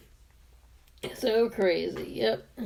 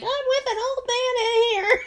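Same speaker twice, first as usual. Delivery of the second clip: high, laughing old lady impression; high old woman voice; 11 semitones higher than usual. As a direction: squeak it like the wife. Imitating the wife.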